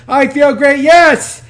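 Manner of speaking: loud, high, pumped-up shout; all out. Shown as a demonstration. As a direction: fire it off at full energy. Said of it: "go as fast as you can. All out".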